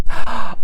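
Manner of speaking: sharp, loud intake of breath; imitated surprised gasp. Vlogger acting out her manicurist's delighted shock, surprised gasp. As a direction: gasp as if astonished.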